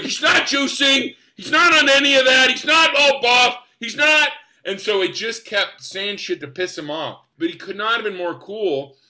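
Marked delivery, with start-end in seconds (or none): 0.0-4.3 high, loud imitation of an exasperated denial; pissed off. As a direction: yell each denial in mock outrage, drawing it out. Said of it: "getting more and more pissed off".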